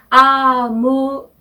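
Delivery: slow careful amoureux; French pronunciation. Shown as a demonstration. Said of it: "이르면".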